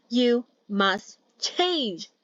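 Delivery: very slow, every word hit; you must change, word by word; each word stressed. Acted. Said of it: "Ken emphasized each word".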